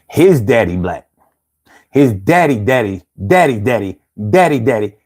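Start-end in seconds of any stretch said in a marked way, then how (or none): none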